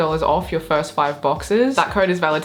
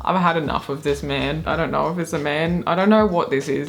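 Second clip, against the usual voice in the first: usual speaking voice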